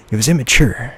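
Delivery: plain reading voice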